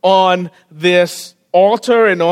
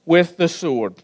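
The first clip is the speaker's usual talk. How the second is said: scripture reading; 4 semitones lower than usual. Acted. Preacher reading First Kings to the congregation, hushed and reverent. Reads scripture passage aloud.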